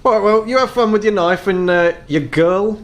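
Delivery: high elf voice, hesitating at the end; quizzical elf shopkeeper. Acted. Elf sees off the customer and his girl, high and quizzical. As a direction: pitch it up, puzzled but civil. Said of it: "with a quizzical look, just kinda says".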